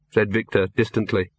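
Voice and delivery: usual speaking voice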